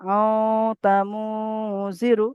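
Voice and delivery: আও তা মু drawn out very slowly through the nose, then জিরু; slow nasal recitation. Shown as a demonstration. Teacher reciting slowly for students to copy, nasal. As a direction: stretch আও তা মু with a long nasal hum. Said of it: "তাহলে এখানে কেমন উচ্চারণ হবে?".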